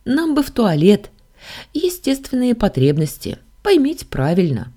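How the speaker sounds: high, embarrassed pleading; sheepish, high-pitched explanation; awkward request. A narrator's reading. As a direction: ask apologetically in a high voice. Heroine awkwardly asks god for toilet.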